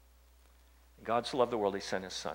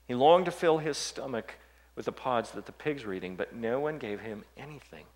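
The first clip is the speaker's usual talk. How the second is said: ordinary talk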